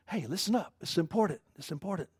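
quiet, emphatic aside; hushed urgency. Retold or imitated. Voicing "verily, verily" to listeners, urgent but hushed. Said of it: "it's kind of an exclamation point".

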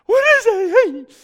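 drawn-out, very high comic shriek; mock terrified scream. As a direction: scream slowly in hammed-up terror. Voices frightened disciples seeing a ghost.

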